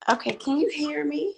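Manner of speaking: just-woke-up morning voice; groggy morning voice. Real, not acted. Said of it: "excuse my morning voice".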